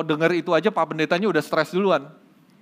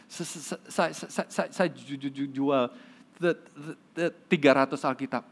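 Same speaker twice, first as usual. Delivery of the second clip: halting stutter, repeating every first sound; a shy stutterer struggles to get the words out; stuttering man; 4 dB quieter than usual. Acted. Nervous stutterer talks to the pastor, stuttering hard. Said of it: "orang gagap ini bilang".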